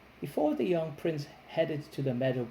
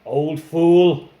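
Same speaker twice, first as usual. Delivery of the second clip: loud scornful dragon voice; mocking monster; 12 dB louder than usual. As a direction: sneer it at her like a dragon. Dragon mocks old woman.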